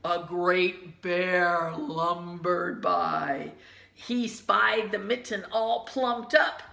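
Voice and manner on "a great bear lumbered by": slow bear. A narrator's reading. Bear arrives, slow heavy read.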